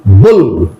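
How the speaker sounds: "bull" with a short oo like in "book"; teacher demonstrating "bull" with a short oo. Shown as a demonstration. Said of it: "U pronounced as O".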